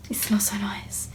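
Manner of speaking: slow whisper, savouring the smell. Real, not acted. Sniffing the new item.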